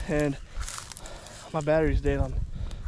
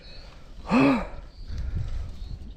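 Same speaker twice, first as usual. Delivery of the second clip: gasp for air; 8 semitones higher than usual. Real, not acted. Just ran over, panting.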